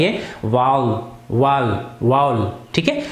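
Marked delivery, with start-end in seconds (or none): 0.0-1.0 वावल said as "vaul", one gliding syllable; teacher models वावल with an ow sound; vowel said right. Shown as a demonstration. Teacher shows students the real pronunciation of vowel, said slowly and clearly. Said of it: "इसका real pronunciation जो है". 2.0-2.5 वावल as "vaul", with an ow glide; vowel pronunciation. Shown as a demonstration. Teacher repeats the correct form of vowel for students, clearly. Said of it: "इसका real pronunciation है वावल".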